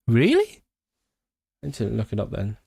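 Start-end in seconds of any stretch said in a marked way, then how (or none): 0.1-0.6 high, sharply rising question; incredulous surprise. Real, not acted. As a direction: ask it in real disbelief. Learns two place names are one.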